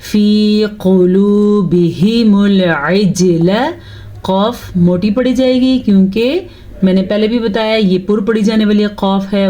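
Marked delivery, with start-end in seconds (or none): 0.1-3.5 slow melodic tajweed recitation; Quran recitation. Shown as a demonstration. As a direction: draw the vowels out like a Quran reciter. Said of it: "پھر سے سنیں".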